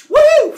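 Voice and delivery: sudden loud, high whoop; explosive high takeoff noise; whooshing "woo". Retold or imitated. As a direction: imitate a rider shooting off with a whoop. Phaethon takes off in chariot.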